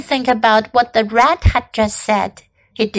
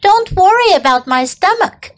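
higher snapping retort; snarled; 7 semitones higher, 3 dB louder than usual. Acted. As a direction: snarl it back at the spider, pitched up. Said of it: "snarled Templeton".

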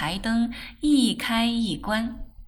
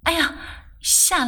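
mildly startled, higher-pitched "哎呀"; startled cry; 7 semitones higher than usual. A narrator's reading. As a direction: give a small jump of surprise on the exclamation. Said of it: "曼桢哎呦了一声". (略带惊吓地轻呼)

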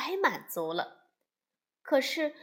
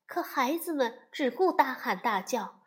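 plain reading voice

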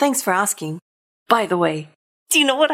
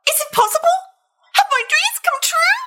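excited gasp. Acted. Pigeon hopes for a puppy.

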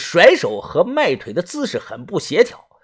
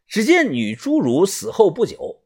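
usual speaking voice

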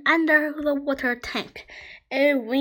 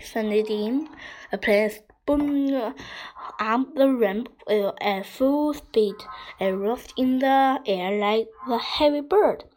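usual speaking voice

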